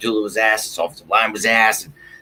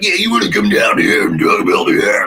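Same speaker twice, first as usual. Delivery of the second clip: loud, garbled shouting troll; hammed-up aggressive rant; mock weirdo heckler; 6 dB louder than usual. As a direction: imitate a belligerent heckler yelling. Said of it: "that weirdo, like, Trumpy energy where they're like".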